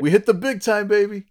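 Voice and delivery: high, loud hype-man boast; loud, high-pitched celebration; triumphant. Real, not acted. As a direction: crow it like a big win. Mock-triumphant announcement of iTunes.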